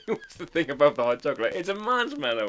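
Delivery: loud, high, through real laughter; laughing while talking. Real, not acted. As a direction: talk through a laughing fit. Amused at forgetting marshmallow.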